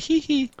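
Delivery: high mock giggle. Host mimics his co-host's giggle, high mock giggle. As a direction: squeak out a silly little giggle. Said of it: "You're like".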